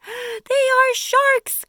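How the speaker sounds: slow, high, loud wail of alarm; a panicked worm shrieking at the sight of sharks; terrified cry. Acted. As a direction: scream out the danger. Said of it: "cried the earthworm".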